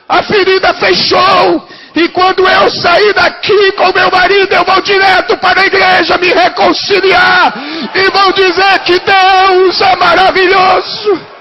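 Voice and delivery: loud, high, shouting sermon climax; preaching at full force. Acted. Pastor shouting the healing testimony to the congregation, full force preaching. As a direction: proclaim the miracle at the top of your voice.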